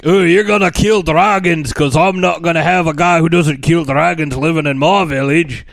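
put-on loud, blustering Scottish accent; mock Scottish Viking dad. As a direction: lay down the law in hammed-up Scottish. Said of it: "I'm a Scottish Viking".

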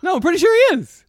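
very high, loud objection; incredulous insistence. Real, not acted. Contradicting co-host about Foley being British, incredulous. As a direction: insist in a high, disbelieving squeal.

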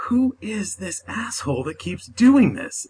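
mock-indignant inner voice; exasperated. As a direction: replay your baffled irritation, drawn out. Retelling her old irritation online.

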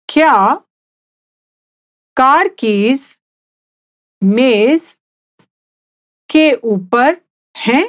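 slow, each word set apart with pauses; slowly, word by word. Shown as a demonstration. Teacher repeating for the listener to copy, slow and word by word. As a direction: spell it out slowly for a learner. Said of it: "I'll say it again slowly".

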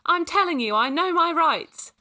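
high, loud stubborn protest; stubborn insistence. A narrator's reading. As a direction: dig in loudly, drawing the words out. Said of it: "Kaylee said stubbornly".

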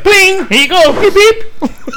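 high beeping robot voice; mock robot. Joking about R2-D2 making pizza, beeping. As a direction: chirp like a droid serving food.

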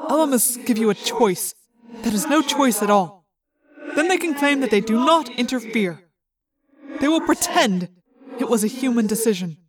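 measured alien voice with a hollow echo; echoing, formal alien speech; alien companion. A narrator's reading. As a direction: state it evenly as an alien.

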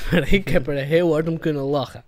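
usual speaking voice

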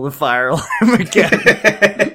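quoting while starting to laugh; laughing retelling. Retold or imitated. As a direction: crack up partway through the quote. Laughs while retelling principal's quote.

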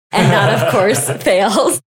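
said through a real laugh; amused laughing. Real, not acted. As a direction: let laughter break into the words. Laughs over the silly definition.

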